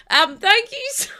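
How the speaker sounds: high, slow, laughing through the words; can't stop giggling while thanking; laughing thanks. Real, not acted. Streamer thanking chat while laughing at their prank, giggling.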